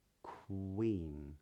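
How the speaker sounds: "queen" as k, drawn-out oo, then een; rounded W glide. Shown as a demonstration. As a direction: stretch the hidden W in "queen". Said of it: "sneaks its way into Q U words".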